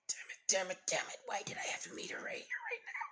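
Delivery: frantic whispered cursing, high and breathless; internal cursing whisper. Acted. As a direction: hiss curses under your breath in a panic. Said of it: "screaming and cursing at herself".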